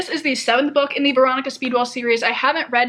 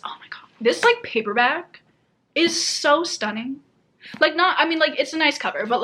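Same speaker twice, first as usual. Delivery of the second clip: usual speaking voice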